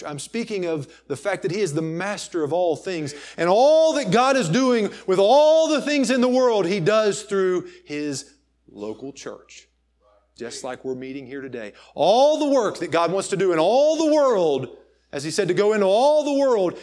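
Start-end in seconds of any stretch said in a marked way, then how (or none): 3.4-9.7 loud, high preacher's cry; fervent pulpit proclamation; preaching at full force. Acted. Preacher builds to a peak. 11.9-16.8 loud, high preacher's cry; fervent pulpit proclamation; preaching at full force. Acted. Preacher builds to a peak.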